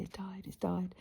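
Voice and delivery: soft, hushed and gentle; very gently. Retold or imitated. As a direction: break the news tenderly, almost whispering. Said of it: "I said very gently".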